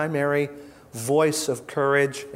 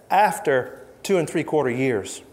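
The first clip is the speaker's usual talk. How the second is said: ordinary talk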